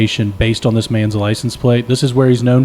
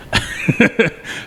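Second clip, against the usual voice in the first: high, airy chuckle; breathy run of chuckles, no words; amused laugh; 9 semitones higher, 4 dB quieter than usual. Real, not acted. Storyteller amused with his co-host, high chuckling.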